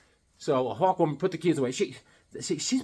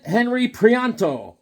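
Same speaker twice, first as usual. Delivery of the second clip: ordinary delivery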